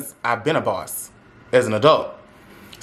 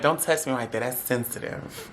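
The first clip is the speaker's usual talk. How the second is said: hushed protest; 2 semitones lower, 9 dB quieter than usual. Retold or imitated. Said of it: "I'm like".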